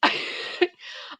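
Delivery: high, breathy burst of laughter; giggle. Real, not acted. Streamer amused by own teasing, giggling. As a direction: giggle helplessly.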